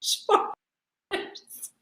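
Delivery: breathy whispered word; whispering. Acted. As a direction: whisper it unvoiced.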